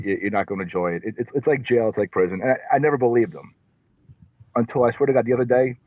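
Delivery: ordinary talk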